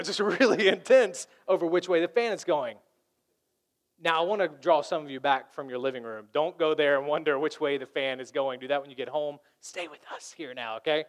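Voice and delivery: ordinary talk, nothing marked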